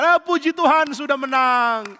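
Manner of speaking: high, loud, slow joyful cheer; excited cheer. Acted. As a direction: greet the returning army with joy. Waiting men greet victors.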